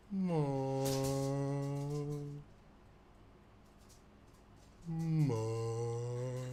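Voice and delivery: the punchline, said plainly slow; Party Will delivers the punchline to the bartender; deadpan Mom call. Acted. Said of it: "looks him in the eye and goes".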